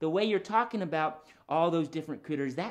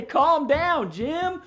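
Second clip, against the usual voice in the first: loud, raised-pitch calming call; group call-out; 11 semitones higher, 7 dB louder, much slower than usual. Shown as a demonstration. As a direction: lead everyone in telling the dog to settle. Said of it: "Everybody say".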